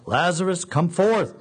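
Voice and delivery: calling out high and loud; a ringing loud summons into the tomb; loud cry. Acted. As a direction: cry it out to raise the dead man. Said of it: "he cried out in a loud voice".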